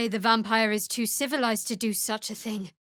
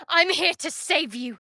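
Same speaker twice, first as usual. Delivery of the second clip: higher, urgent call; urgent reassurance; 7 semitones higher than usual. Acted. Cleric rushing in to rescue a prisoner, urgent and raised. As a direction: reach them quickly.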